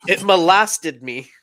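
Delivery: deadpan pun, slow and loud; mock dramatic.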